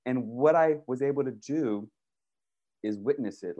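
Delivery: ordinary delivery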